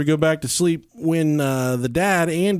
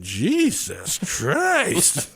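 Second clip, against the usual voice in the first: put-on four-year-old exclaiming; 3 semitones higher, much slower than usual. Daughter's retold exclamation, slow kid voice. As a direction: stretch it like an unimpressed kid. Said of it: "but she went".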